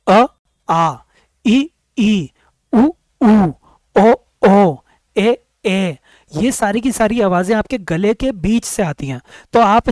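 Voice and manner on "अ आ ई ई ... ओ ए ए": each vowel sound drawn out and clearly voiced; vowel sounds demo. Shown as a demonstration. Teacher sounding out vowels for students. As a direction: sound out the Hindi vowels one by one. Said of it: "क्या?".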